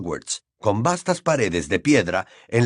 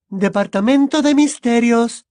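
much higher, louder level announcer; female lift voice; 12 semitones higher, 5 dB louder than usual. Acted. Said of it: "anunció la voz femenina".

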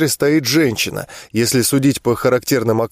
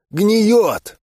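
short guttural growl, higher; orc growl; 6 semitones higher than usual. A narrator's reading. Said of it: "орк лаконично рыкнул".

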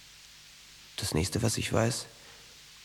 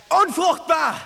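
shrill screaming cry; 9 dB louder, much slower than usual. Acted. Birdie screaming at Weiß in the cell. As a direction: scream it out at the top of the lungs. Acted prison chaos scene.

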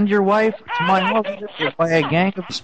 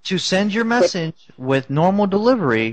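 answering machine voice. Pretend. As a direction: recite menu like a robot system. Fake voicemail menu.

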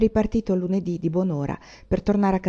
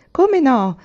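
bright, eager yes, higher and louder; excited exclamation; 7 semitones higher, 6 dB louder than usual. A narrator's reading. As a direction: jump at the invitation. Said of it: "esclamò Laura". (esclamazione entusiasta, più alta e più forte)

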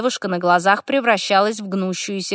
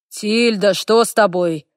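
worried. A narrator's reading. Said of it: "Встревоженный голос Десса".

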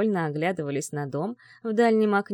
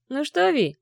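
higher, louder impatient call; 8 semitones higher, 5 dB louder than usual. Acted. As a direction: call her over eagerly. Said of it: "позвал синеглазый".

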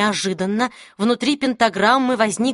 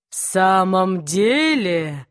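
mocking demon; 3 semitones lower, much slower than usual. Demon mocks the mage.

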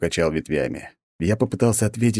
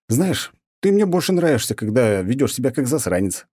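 higher teasing voice with a smile; smiling jab; 7 semitones higher than usual. A narrator's reading. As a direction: tease him back. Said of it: "улыбнулся я". (с улыбкой, подтрунивая)

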